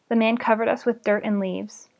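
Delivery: usual speaking voice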